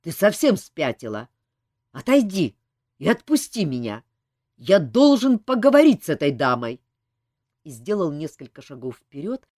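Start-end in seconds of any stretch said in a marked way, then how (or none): 0.0-4.0 louder angry demands; angry shout. A narrator's reading. Elmar furious, pulling away. 4.6-6.8 louder insistent demand. A narrator's reading. Elmar's first loud demand.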